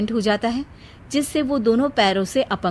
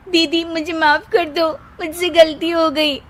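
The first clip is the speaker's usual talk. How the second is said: remorseful sister-in-law; 7 semitones higher, 4 dB louder than usual. Acted.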